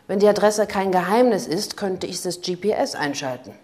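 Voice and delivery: loud, mocking jab; sarcastic. A narrator's reading. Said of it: "Geht's auch ohne Sarkasmus?". (laut und sarkastisch spöttelnd)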